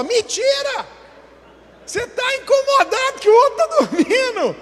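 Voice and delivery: high, full-voice accusing shout; indignant shouted rebuke, very loud and high; loud scolding. Acted. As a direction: yell it out, pitch soaring. Yelling at the noisy early riser.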